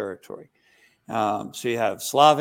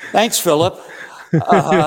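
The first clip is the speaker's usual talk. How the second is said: laughing, pitched up and louder; 6 semitones higher, 10 dB louder, slower than usual. Real, not acted. Priest laughing at a listener's huge question. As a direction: laugh through the thanks.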